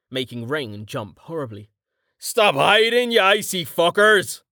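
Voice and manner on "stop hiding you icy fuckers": high, brash roared taunt at hidden monsters; roaring taunt. Acted. Carten taunts hidden monsters with a high roar. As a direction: yell a dare at the unseen enemy. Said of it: "Carten roared".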